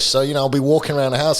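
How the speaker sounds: ordinary talk, nothing marked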